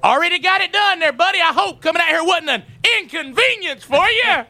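high sing-song sarcastic taunt; mock sweet taunt. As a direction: needle him with syrupy sarcasm. Mocking the late installer.

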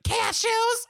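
high-pitched imitation of an alarmed kid; mock student shriek. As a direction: cry it out like a panicked student. Student shouts answer in story.

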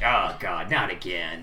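low, resigned groan of a line; exasperated under the breath; weary dismay. Acted. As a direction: sigh it out low, here we go again. Guard's weary reaction to the grapple.

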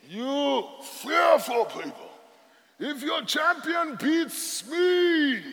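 lightly acted giant taunting the army; a hint of a bullying giant. Preacher voicing Goliath taunting Israel, mild mock yell. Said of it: "is screaming at them".

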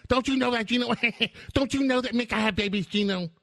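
high, fast, mock-incredulous question; hammed-up sarcastic disbelief; high mocking sarcasm. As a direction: taunt him with a high sing-song mock, quickly. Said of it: "you're gonna mock me".

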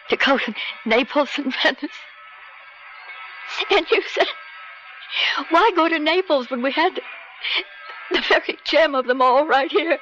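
high, sobbing voice breaking up the phrases; tearful reminiscing. Acted. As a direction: choke out the memory through rising tears. Breaks down recalling their happy times.

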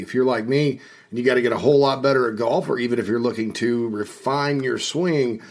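upbeat sponsor read for a golf coach. Acted. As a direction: sell it like a radio spot. Host reads sponsor ad.

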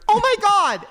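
high, loud shriek of alarm; a startled friend screams on waking; retold scream. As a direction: shriek it like someone in a horror movie. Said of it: "I hear".

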